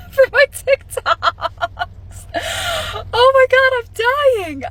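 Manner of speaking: can't stop laughing as you say it; laughing through it. Real, not acted. Vlogger laughing about being fired for her TikToks, laughing through the words.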